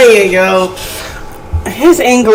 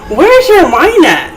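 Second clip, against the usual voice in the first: mocking question; 11 semitones higher than usual. Real, not acted. Mocking his word choice.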